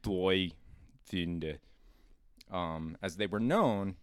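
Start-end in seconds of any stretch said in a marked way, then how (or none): 0.0-1.6 mock German words passed off as Swedish; fake foreign language. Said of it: "bastardize German to make a fake Swedish".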